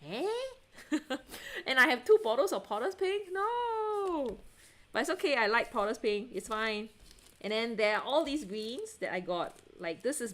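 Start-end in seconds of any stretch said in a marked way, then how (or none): 3.3-4.4 dismayed no. Real, not acted. Dismay over two duplicate paint bottles.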